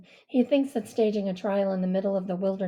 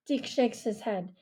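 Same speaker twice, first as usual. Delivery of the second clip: usual speaking voice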